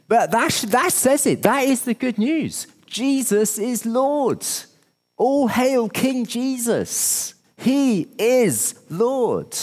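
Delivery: high, slow and ringing proclamation; full-force preaching. Real, not acted. Preacher declaring the good news to the congregation, soaring and slow.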